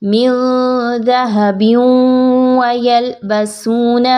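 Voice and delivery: slow melodic tajweed chant; Quranic recitation. Shown as a demonstration. Teacher reciting the next verse, melodic chant. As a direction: recite slowly with held vowels.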